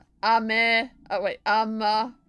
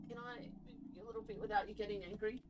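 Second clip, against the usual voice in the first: soft coaxing; 18 dB quieter, faster than usual. Real, not acted. Gently asking the pet to move.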